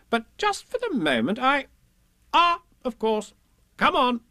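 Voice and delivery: high voice, breaking off and recovering; bluffing Rabbit. A narrator's reading. Rabbit hiding that he is lost, high and bossy. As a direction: hesitate high, then take command.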